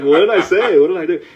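mock-alarmed bewilderment; bewildered protest. Retelling bewilderment at cashier's stare.

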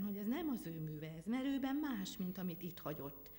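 ordinary delivery

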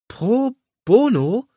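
high, slow disbelieving echo; confused stammer. Acted. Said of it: "Stammelte ich verwirrt".

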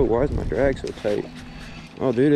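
ordinary talk, nothing marked

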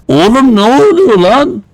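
startled. Real, not acted. Something strange happens.

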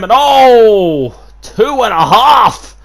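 high, loud near-fall call; excited shout. Real, not acted. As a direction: yell the count in shock.

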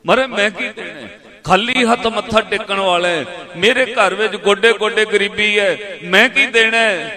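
loud, high retold plea of helplessness; impassioned, pleading storyteller's voice; tearful plea. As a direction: cry out as a poor man with nothing to offer. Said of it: "ਰੋ ਪਿਆ".

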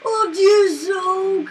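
gasp, then high, loud exclamation; scared. Real, not acted. Player shaken by spiders, startled and scared. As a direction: still jolted by a fright. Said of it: "That scared me so bad".

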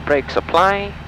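normal talking voice

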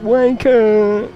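high, loud shouted insult. Real, not acted. Streamer hurling an insult at a viewer, loud and high. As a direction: shout it at the screen.

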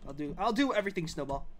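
ordinary delivery

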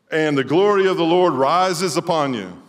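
booming reading of Isaiah; scripture reading. Acted. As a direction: read it out like a prophecy. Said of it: "It goes like this".

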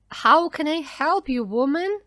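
exasperated shout. A narrator's reading. Daniel shouting at Missus Dawson, exasperated. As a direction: shout back at her in frustration. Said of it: "Daniel shouted at Missus Dawson".

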